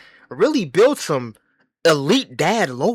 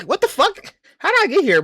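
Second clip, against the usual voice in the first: mock bewilderment; 13 semitones higher, 5 dB louder than usual. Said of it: "sometimes I question, like".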